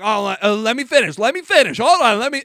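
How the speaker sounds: high, loud imitation of someone cut off; indignant protest. Imitating the roommate demanding to finish, loud indignant protest. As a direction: build in volume fighting to get a word in.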